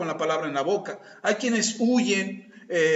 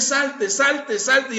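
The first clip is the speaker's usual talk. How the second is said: mock exasperated repeat, higher; repeated order; 5 semitones higher, 3 dB louder, much slower than usual. Speaker with no other words ordering the other out. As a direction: hammer the one word. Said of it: "lo único que dices es".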